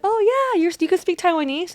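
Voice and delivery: put-on high, bright pitch of a pleased old lady; surprised delight. Market lady delighted he speaks Taiwanese, high and surprised. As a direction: mimic the lady's happy surprise, up high. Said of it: "but she was like".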